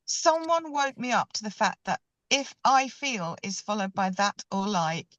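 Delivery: ordinary delivery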